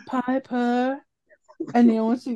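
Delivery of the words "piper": high-pitched "Piper" with the final r dropped; odd name pronunciation. Retold or imitated. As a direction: say "Piper" as Pie-puh, mimicking the actress. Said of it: "she's like".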